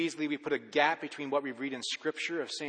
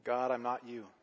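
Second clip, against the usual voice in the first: spoken prayer; 4 dB quieter than usual. Shown as a demonstration. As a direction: pray it quietly, as if alone with God. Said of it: "modelling a prayer".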